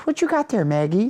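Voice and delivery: high, sing-song imitation of mom; mom talking to dog.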